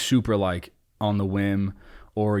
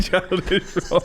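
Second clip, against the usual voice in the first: laughing; 10 semitones higher, slower than usual. Real, not acted. Host laughing as he teases his guest, laughing. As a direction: laugh out the word.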